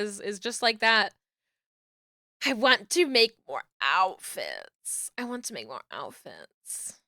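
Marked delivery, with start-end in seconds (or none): 5.2-7.0 much lower and softer; hushed repeat. Real, not acted. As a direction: say it low, almost to yourself. Repeats wish to self, hushed.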